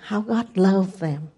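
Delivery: usual speaking voice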